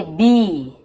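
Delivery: बी drawn out with a long ee. Shown as a demonstration. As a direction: stretch the vowel in बी slowly. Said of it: "जैसे बड़ी की मात्रा बोलते हैं". (बी में बड़ी ई की मात्रा)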